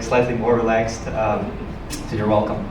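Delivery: usual speaking voice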